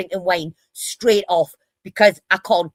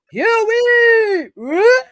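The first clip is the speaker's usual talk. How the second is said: high, loud swooping imitation retch; hammed-up heaving noises, pitch shooting right up; mock retching call; 13 semitones higher, 4 dB louder than usual. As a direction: mimic a mate throwing up, teasingly. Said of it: "Fucking spewing his guts up".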